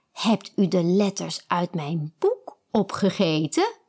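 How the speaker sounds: usual speaking voice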